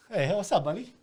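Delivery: high, sweet voice for the cat; talking to a pet. Real, not acted. Greeting the cat.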